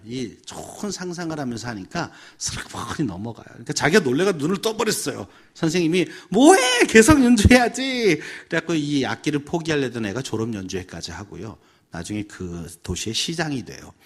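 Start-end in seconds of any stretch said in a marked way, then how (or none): 6.3-8.2 high, loud, drawn-out urging; urgent teacher's shout. Retold or imitated. Teacher to student who stopped playing, urgent shout. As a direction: shout it out to keep them going.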